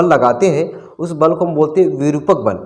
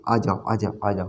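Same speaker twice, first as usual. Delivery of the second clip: soft, low, beckoning come-here; coaxing invitation; 8 semitones lower, 10 dB quieter than usual. Pretend. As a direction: softly lure a friend closer. Opposite charges invite each other.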